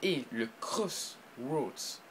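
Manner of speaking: very slow, drawn-out English pronunciation of "Crossroads"; French speaker putting on a clumsy English accent for "Crossroads". Real, not acted. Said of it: "avec mon accent de merde".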